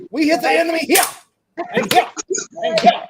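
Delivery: high, hammed-up German officer giving orders; theatrical German military briefing; mock German accent. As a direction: jab out battle orders in a put-on German accent. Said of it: "if I turn German".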